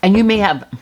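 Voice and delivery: words cut off by a sip of water; drinking mid-sentence. Real, not acted. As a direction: talk around a sip of sparkling water. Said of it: "sorry, drinking my sparkling water".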